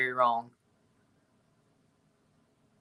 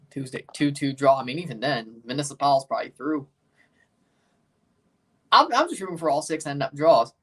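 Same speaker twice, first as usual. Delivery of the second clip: ordinary delivery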